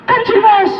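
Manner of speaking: very high-pitched protest; mock exasperation. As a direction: burst out high, as if fed up. Retold exasperation at wife's indirectness.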